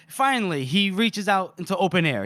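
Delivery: usual speaking voice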